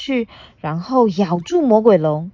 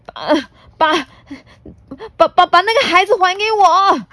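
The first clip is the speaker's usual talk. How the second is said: stammering, high, louder and fast; trembling demand; 12 semitones higher, 5 dB louder than usual. Acted. T-rex demanding the child back, stammering in fear. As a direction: stutter it out shaking with fear. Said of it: "霸王龙发着抖说".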